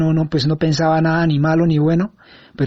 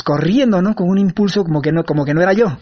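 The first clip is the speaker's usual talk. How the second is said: ordinary talk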